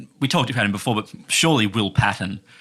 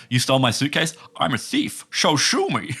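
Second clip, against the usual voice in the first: light Connery Scottish impression; Scottish accent; 4 semitones higher than usual. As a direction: roll it out in Scottish.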